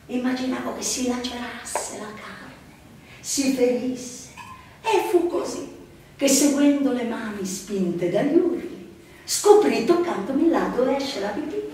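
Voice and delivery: usual speaking voice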